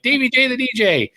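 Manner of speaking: high, playful on-air announcement; lightly put-on DJ intro; radio DJ name. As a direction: sing out your new DJ name. Retells his first on-air name.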